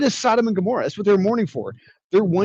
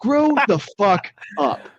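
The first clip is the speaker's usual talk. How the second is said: drawn-out scolding; 5 semitones higher, slower than usual. Retold or imitated. Scolding the characters.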